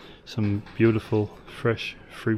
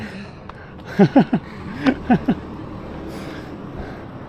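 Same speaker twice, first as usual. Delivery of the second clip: laughing; 5 dB louder than usual. Real, not acted. Finding the right room.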